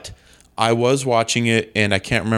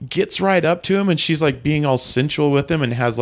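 ordinary delivery

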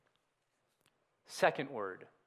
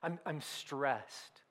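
voicing Jesus troubled; 7 semitones lower, 12 dB quieter than usual. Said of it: "He goes".

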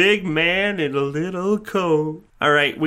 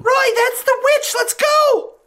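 high frantic call, much higher; scared child shout; slower than usual. Acted. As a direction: yell at Roy to run. Child warning friend.

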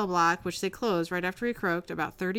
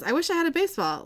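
higher, quick call across the cells; called out loudly; 9 semitones higher, faster than usual. Retold or imitated. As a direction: call it out to the next cell. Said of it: "He says very loudly".

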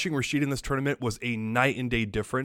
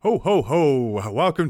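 slow, booming Santa laugh; jolly ho ho ho; 4 dB louder, slower than usual. Pretend. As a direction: belt out a hearty Santa ho ho ho. Christmas episode, festive Santa hat.

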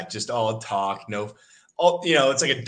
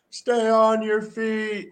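coach's call; 11 semitones higher, 3 dB louder, much slower than usual. Retold or imitated. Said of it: "Calf is".

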